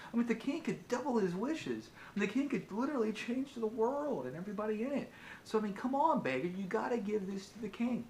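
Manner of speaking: high, indignant king ranting; furious king. Acted. King ranting at the selfish beggar to hand over the lamp. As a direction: push it up high in outraged disbelief. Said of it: "in fury and anger".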